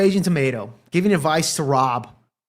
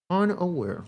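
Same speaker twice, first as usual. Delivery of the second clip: eerie hushed echo, much softer and changed in tone; mock dramatic; 8 dB quieter than usual. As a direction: whisper it like a spooky echo. Dramatic repeat, far voice.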